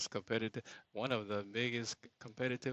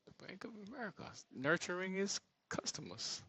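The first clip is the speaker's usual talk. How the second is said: whispered awe; 9 semitones higher, 6 dB quieter than usual. Real, not acted. Awed aside about bank.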